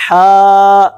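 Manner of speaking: ہا drawn out from the middle of the throat; Arabic haa as a model; throaty ha. Shown as a demonstration. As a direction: breathe a long ha from mid-throat. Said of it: "کیسے؟".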